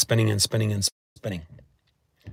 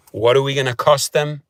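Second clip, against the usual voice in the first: ordinary talk, nothing marked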